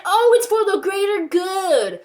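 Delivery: high, loud, drawn-out mimicry; mocking quote. Quoting what they're saying to justify it, mockingly. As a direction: parody a pious excuse, slow and high. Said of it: "And they're saying".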